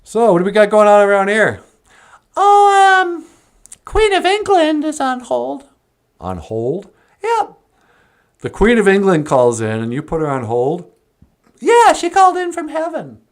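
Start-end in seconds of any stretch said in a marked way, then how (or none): 2.4-5.6 very high, bright greeting; a cheerful high puppet hello; Natasha's puppet voice. Acted. Puppet Natasha reports call. 7.2-7.5 very high, bright greeting; a cheerful high puppet hello; Natasha's puppet voice. Acted. 11.6-13.2 very high, bright greeting; a cheerful high puppet hello; Natasha's puppet voice. Acted.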